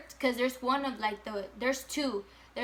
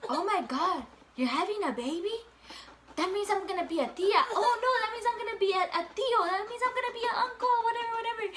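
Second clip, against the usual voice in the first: put-on much higher, fast, giddy kid voices; excited little siblings; 11 semitones higher, 4 dB louder, faster than usual. Little brothers and sisters hearing about the baby, giddy high excitement. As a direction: bounce with childish glee at the news. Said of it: "they're like".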